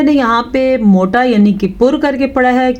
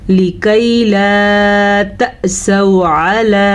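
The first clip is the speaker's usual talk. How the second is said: slow, drawn-out tajweed chant; slow melodic Quran recitation; Quranic recitation; much slower than usual. Shown as a demonstration. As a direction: recite with long, measured vowels.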